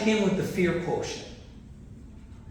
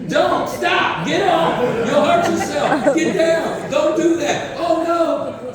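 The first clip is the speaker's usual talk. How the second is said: high, loud mock-panicked parent yelling at a kid; anxious parent yelling; 11 semitones higher, 6 dB louder, slower than usual. Playground parents shouting fearful warnings at their kids, mock-panicked yell.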